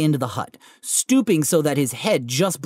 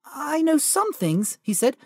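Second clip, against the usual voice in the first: put-on defensive boy; 9 semitones higher, slower than usual. Harry protesting meekly.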